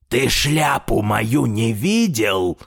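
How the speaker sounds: slow, controlled put-on old man's voice; strained composure. A narrator's reading. Grandfather asks tailor about his hat, keeping his composure. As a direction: ask slowly, holding yourself together. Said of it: "стараясь не терять самообладание".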